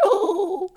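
high squeaky mock gobble call; tiny turkey gobble from a little kid; kid's turkey gobble. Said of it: "Show 'em how you gobble".

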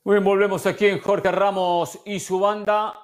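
loud on-air return from break; radio host announcement. Real, not acted. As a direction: announce the show back from the break.

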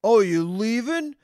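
lightly imitating a drunk stranger, drawn out; drunk guy's quip. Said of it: "the guy goes to me".

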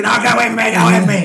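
high, loud frantic warning; retold panicked shout. Infected father warns his daughter away, panicked shout.

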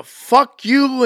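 loud mock shout of fuck you; retelling the bar clash, loud mock anger; mock angry shout. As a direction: bark it like the guy starting the fight. Acted bar confrontation.

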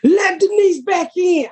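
high, drawn-out audience holler; fans yelling out. Retold or imitated. Fan shouting show lines at the comedian, high holler. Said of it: "started to yell out".

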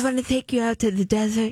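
deep mumbling movie star; Marlon Brando impression. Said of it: "that's from Marlon".

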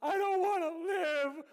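high, loud, slow mock wail; overdramatic despair. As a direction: ham up a self-pitying whine. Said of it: "started getting overdramatic and saying".

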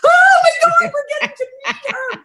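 high squealing shout breaking into laughter; starstruck squeal. Retold or imitated. Actress recalling the waiting room, squealing and laughing with excitement. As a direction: shriek it like a starstruck fan, then giggle.

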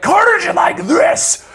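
high-pitched, scratchy villain voice, drawn out; Carnage. Shown as a demonstration. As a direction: screech it slowly and loudly. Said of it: "It's always so high pitched and kinda scratchy".

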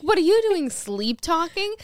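high, loud mock accusation; hammed-up exasperated comeback; retold incredulous retort. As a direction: fling it back, drawing out the last words. Acted retort to sleep talker.

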